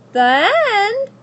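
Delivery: high, loud, rising outrage; indignant child. Acted. Abilene indignant at the story's ending. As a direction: protest in disbelief. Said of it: "said Abilene indignantly".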